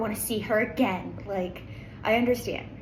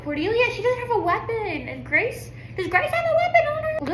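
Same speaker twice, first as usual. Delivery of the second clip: much higher and louder, anxious; alarmed excitement; 11 semitones higher, 7 dB louder than usual. Real, not acted. Reader fearing for characters without weapons, alarmed. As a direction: voice jumps in alarm. Worried during a fight scene.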